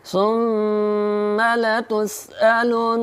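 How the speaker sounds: very slow melodic recitation, nasal hum on the doubled nun; slow Quran chant with nasal ghunna. Shown as a demonstration. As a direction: draw out لترون with a humming nasal. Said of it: "میم کو بھی جلدی سے پڑھنا ہے".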